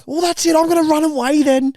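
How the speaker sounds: loud, high mock tantrum threat; hammed-up childish indignation; huffy child. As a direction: declare it like a kid storming off. Child threatening to run away.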